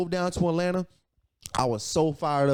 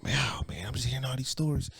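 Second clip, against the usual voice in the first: softer, awed aside under the breath; hushed awe; 6 dB quieter than usual. Retold or imitated. Newcomer hearing big success stories, hushed and amazed. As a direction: murmur it like you're stunned by the room.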